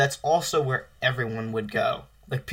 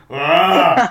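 zombie groan; 3 semitones higher, 9 dB louder than usual. Pretend. Zombie noises, zombie intro.

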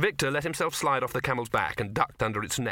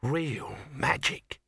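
slow, hushed wonder; breathed in awe; 3 semitones higher, much slower than usual. A narrator's reading. Sol awed by the filming, slow hushed breath. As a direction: breathe it out in amazement. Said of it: "breathed Sol".

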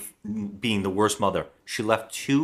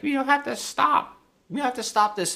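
overacted pleading; 13 semitones higher than usual. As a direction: beg like a melodrama actress. Wife pleading, imitated.

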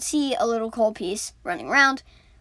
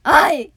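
yelp; 5 semitones higher, 11 dB louder than usual. Acted. Pain cry after toe.

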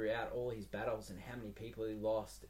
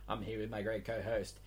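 ordinary talk, nothing marked

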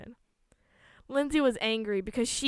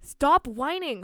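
slow, raised, loud reprimand; exasperated parent's telling-off; scolding; 5 semitones higher, 6 dB louder, much slower than usual. A narrator's reading. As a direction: scold a whining child, stretching each word. Mother scolds whining child.

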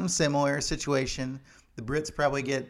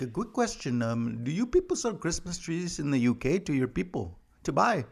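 lightly voiced sly Norwegian asking innocently; a hint of a foreign official asking pointedly; mock Norwegian voice; 3 semitones higher than usual. Norway asking about UK tree sales.

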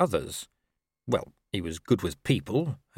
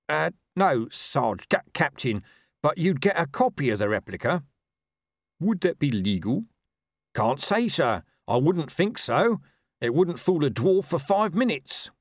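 hesitant, higher-pitched subordinate; watchman Reg; 7 semitones higher than usual. Acted. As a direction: explain to the superior, stumbling over his rank. Reg explains to Colon.

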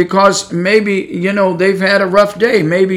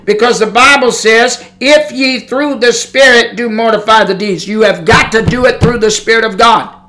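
preaching; 4 semitones higher than usual. Acted. Preacher builds to exhortation.

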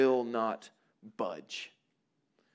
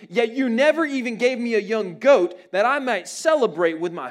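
put-on resentful older brother; 6 semitones higher, 7 dB louder than usual. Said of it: "He was angry and refused".

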